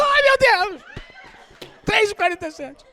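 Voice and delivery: high startled gasp, laughing; startled waking. Acted. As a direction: jolt awake in mock panic, laughing.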